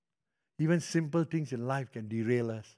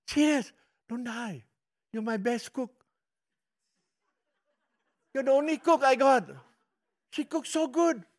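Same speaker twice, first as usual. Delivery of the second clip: pleading; 7 semitones higher than usual. Retold or imitated. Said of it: "because I told her".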